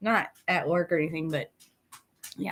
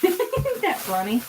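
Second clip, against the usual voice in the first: laughing; 9 semitones higher, slower than usual. Real, not acted. Laughing about the dog.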